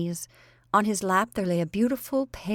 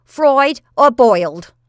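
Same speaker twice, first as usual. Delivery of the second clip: loud, high, slow maid's call; bold voice; 6 semitones higher, 14 dB louder, slower than usual. A narrator's reading. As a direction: bark it boldly from the doorway. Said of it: "asked the bold voice".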